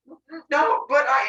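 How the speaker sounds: high, loud stammering; flustered stammer. Acted. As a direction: stumble over your words.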